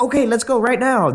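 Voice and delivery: high, put-on overeager voice; mock desperate eagerness. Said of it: "it would have made me look desperate".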